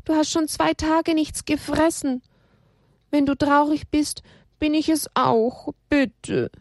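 put-on high, begging child voice; pleading. Elia begging Haberle to get up, high and pleading. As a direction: coax him up, pleading. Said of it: "flehte Elia".

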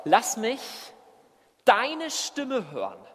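"deine" set off by a pause, high and drawn out; stress on DEINE. Acted. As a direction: lean slowly on "deine", then fall. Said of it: "Er sagt wörtlich". ("deine" hoch und gedehnt betont)

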